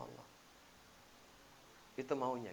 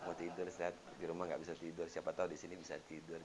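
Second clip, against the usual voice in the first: sheepish request; 12 semitones lower, 5 dB quieter than usual. Retold or imitated. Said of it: "pamit dulu sama ustadznya".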